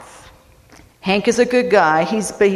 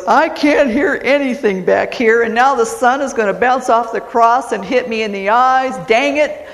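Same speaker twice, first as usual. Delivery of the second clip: higher, hammed-up exasperated Southern griping; Hank, mad; 4 semitones higher, 3 dB louder than usual. Texan grumbles from the last pew, mock-angry Southern accent. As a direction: complain indignantly, peaking on the final curse. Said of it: "he is so mad".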